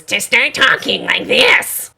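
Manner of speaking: lightly mocking a rasp like an over-rosined bow; mock the narrator's overdone rasp; imitating a raspy narrator. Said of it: "the characteristic rasp of an over-rosined bow".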